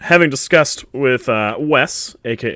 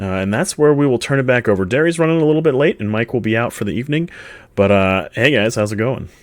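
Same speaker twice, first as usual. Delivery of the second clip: ordinary delivery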